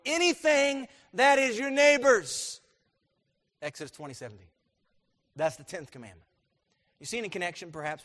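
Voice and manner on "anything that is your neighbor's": high, loud, slowed declaration; forceful climax. Acted. Preacher finishing the tenth commandment, forceful. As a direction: drive the list home loudly and slowly.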